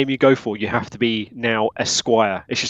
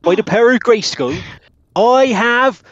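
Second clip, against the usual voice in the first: higher mock-heroic cry in a country accent; He-Man; 8 semitones higher than usual. Player spoofing a hero's catchphrase, raised heroic voice. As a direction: proclaim it as a yokel superhero. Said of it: "more thinking like He-Man".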